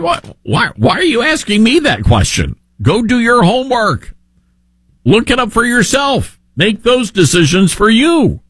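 high-pitched, incredulous retold comeback; mock exasperation, pitched well up; exasperated retort. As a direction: throw it back, baffled they would even ask. Retold exasperated reply to voters.

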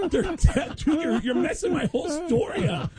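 high mock-annoyed protest; exasperated correction. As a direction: press "They're deaf" in mock frustration. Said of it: "I go".

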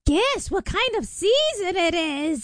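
high, slow cackling witch. Acted. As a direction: creep it out like an old hag. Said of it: "It's my witch voice".

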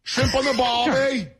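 high, slow hammed-up Aussie accent; exaggerated high-pitched Australian call; mock Australian. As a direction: stretch it out like a cartoon Australian. Mock Australian catchphrase, traded in banter.

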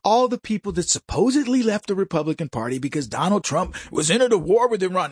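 ordinary talk

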